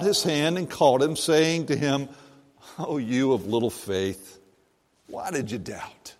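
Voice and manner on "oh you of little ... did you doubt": gentle rebuke. A narrator's reading. Jesus gently rebuking Peter.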